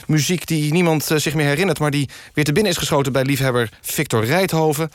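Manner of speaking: usual speaking voice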